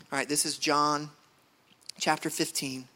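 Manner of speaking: ordinary talk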